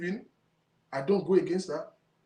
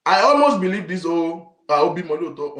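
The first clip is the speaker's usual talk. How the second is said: loud outburst; 11 dB louder than usual. Real, not acted. Ranting to viewers, loud and heated. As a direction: blurt it out like you can't hold it in.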